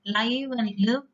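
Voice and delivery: ordinary talk, nothing marked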